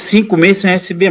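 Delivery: usual speaking voice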